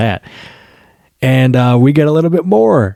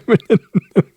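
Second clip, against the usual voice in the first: a sudden high laugh; high burst of laughter; 11 semitones higher than usual. Real, not acted. Laughing at the rude interrupter.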